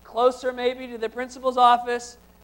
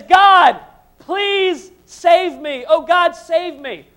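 loud, higher, slow pleading cry; loud cry for help; 5 semitones higher, 10 dB louder, much slower than usual. Acted. Jacob afraid of Esau, loud pleading cry to God. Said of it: "Jacob says".

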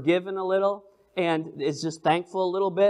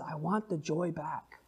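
hushed prayer; 2 semitones lower, 11 dB quieter than usual. Acted. David's prayer of confession.

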